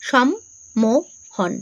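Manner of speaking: "সম্মোহন" very slowly as সম, মো, হন; long pauses between the three syllables of "সম্মোহন"; broken into syllables. Shown as a demonstration. Teacher showing how the word splits, "সম্মোহন" syllable by syllable. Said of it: "যদি ভেঙে ভেঙে বলো".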